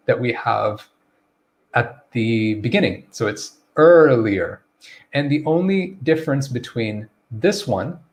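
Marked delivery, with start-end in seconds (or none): 3.8-4.6 slow "earlier" with a long first er; pronunciation demo. Shown as a demonstration. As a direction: stretch out "earlier" as ER-lee-er. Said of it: "So we're gonna stress it".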